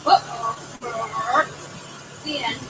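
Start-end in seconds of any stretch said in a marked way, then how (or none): none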